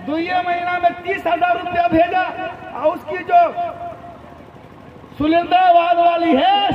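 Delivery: high-pitched wife complaining down the phone; nagging wife. Acted. Wife on the phone demanding money from husband, nagging.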